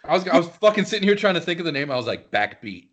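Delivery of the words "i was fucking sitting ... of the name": ordinary talk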